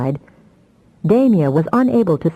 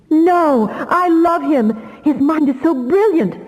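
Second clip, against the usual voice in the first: crying out far higher, slow on love; desperate cry; 11 semitones higher, slower than usual. Acted. Damia defending her lover against her brother, crying out. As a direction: plead for your lover, high and desperate. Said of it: "cried Damia".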